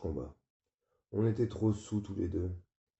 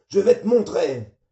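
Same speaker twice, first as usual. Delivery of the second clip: furious high bellow of a father; father yelling; 10 semitones higher, 14 dB louder than usual. Acted. Father yelling at his drunk son at the door.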